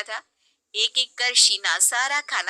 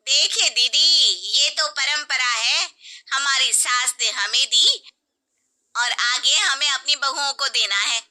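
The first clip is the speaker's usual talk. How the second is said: higher, louder stern lecture; preachy aunt; 4 semitones higher, 4 dB louder than usual. Acted. Aunt lecturing the mother-in-law about tradition, stern. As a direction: defend tradition hotly.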